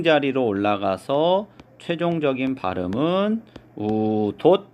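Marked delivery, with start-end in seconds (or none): 3.8-4.7 우돛 as 우돋, ㄷ carried over; pronunciation demo. Shown as a demonstration. Teacher giving students the final pronunciation, 우돛 said as 우돋. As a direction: say 우돛 as 우, then 돋 with a stopped ending. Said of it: "요렇게 발음을 한다".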